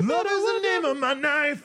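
high, slow and drawn out; high drawn-out mock voice. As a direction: stretch it out in a silly high voice. Mocking the line back, sing-song.

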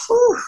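high, loud whew of relief; relieved whew. Retold or imitated. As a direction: blow out a big whew. Relief after the win.

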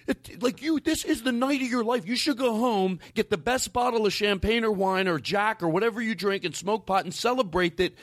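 high, incredulous rant in his head; mock disbelief at her stupidity; exasperated inner rant.

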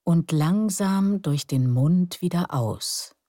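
guided meditation. Acted. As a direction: lull them into calm breathing. Leads breathing relaxation. (ruhige Meditationsstimme)

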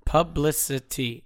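"publicity" sounded out slowly, syllable by syllable; slow, careful pronunciation. Shown as a demonstration. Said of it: "when I say it fast".

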